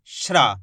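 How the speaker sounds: श्रा as sh and r in one syllable, falling in pitch; blended shra. Shown as a demonstration. Teacher shows learners this way of saying it, blended shra. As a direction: model श्रा with no gap between sh and r. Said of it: "इस तरीके से".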